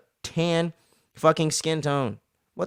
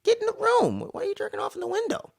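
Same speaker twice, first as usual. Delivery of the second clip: much higher, incredulous mock voice; mock shocked; 9 semitones higher, 4 dB quieter than usual. Mother catching her son at the window, shocked and high-pitched. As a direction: squeal it out in disbelief. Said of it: "I'm like".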